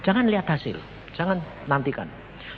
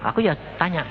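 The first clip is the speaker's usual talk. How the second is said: ordinary delivery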